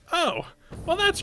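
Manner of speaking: sudden high delight; surprised. Real, not acted. Sudden realization.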